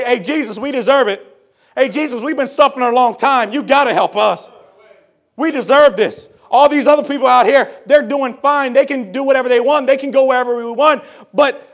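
loud, high mock complaint, building to a shout; acted indignant, impatient plea; entitled demand. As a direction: demand it as if owed. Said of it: "that's not the spirit that they came in".

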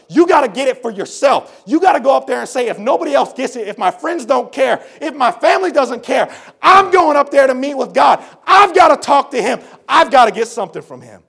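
loud, high, driving sermon voice; fiery preacher climax; preaching at full force. Acted. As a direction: preach it hard to the congregation.

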